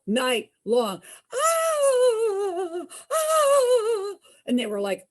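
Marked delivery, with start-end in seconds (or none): 1.3-4.1 high, loud wailing scream "ah, ah"; ghostly wail. Retold or imitated. Screaming heard all night.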